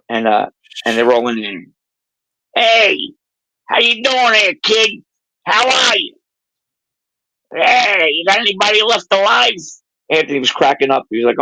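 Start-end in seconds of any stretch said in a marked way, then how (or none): 2.6-9.5 put-on high-pitched old-timer's greeting; elderly social club regular. Old club regulars greeting the newcomers, high elderly voices. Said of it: "nobody in there was under ninety".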